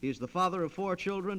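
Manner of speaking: normal talking voice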